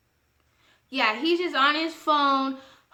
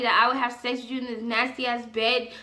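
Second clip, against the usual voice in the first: ordinary delivery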